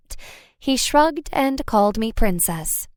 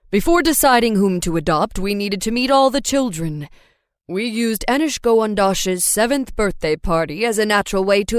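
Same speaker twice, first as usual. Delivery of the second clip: a proud father recalling; dad voice. A narrator's reading.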